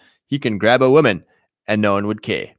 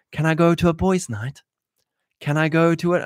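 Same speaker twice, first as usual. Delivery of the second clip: timid husband asking; 5 semitones higher than usual. Retold or imitated. Husband asks wife permission.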